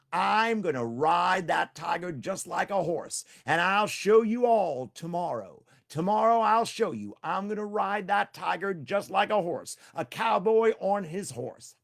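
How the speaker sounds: slow, high, loud promise; Tricky vowing to ride the tiger, loud and slow; loud proud boast. Acted. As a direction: brag it big to the crowd.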